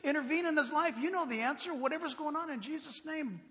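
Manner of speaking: high-pitched, offhand plea to God; re-enacted night prayer. Preacher praying for a newsman at night, acted bewildered prayer. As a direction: rattle off a baffled prayer for a stranger.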